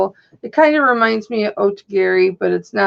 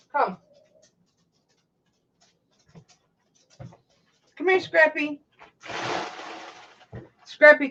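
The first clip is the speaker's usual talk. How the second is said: talking to a dog; 4 semitones higher, 3 dB quieter than usual. Real, not acted.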